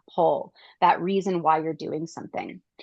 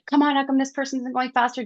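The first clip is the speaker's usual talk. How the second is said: high, fast, mock impatience; freaking out; 7 semitones higher, faster than usual. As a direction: hurry the driver ahead. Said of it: "I'd freak out for a minute".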